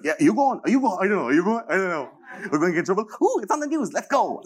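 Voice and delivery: put-on high, fast, giddy kid voices; mock excited teenagers. Mocking teens at the walkout, high and giddy. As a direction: rattle off like giddy schoolkids. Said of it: "these people are like".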